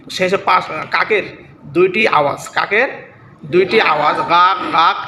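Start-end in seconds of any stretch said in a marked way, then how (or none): 4.3-5.0 two sharp caws like a crow; crow caw imitation. Shown as a demonstration. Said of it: "এই আওয়াজটা মানে দুইটি আওয়াজ". (কাকের ডাকের নকল, জোরালো গাক গাক)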